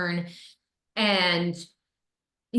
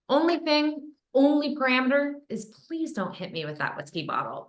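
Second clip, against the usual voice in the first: lightly voiced pleading co-star, higher; a mock nervous request, pitched up; co-star's plea; 8 semitones higher than usual. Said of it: "He was like".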